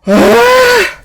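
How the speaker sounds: loud, very high-pitched squeal; excited squeal. Real, not acted. Streamer waiting for the character reveal, high excited squeal. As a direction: let out a burst of pent-up hype.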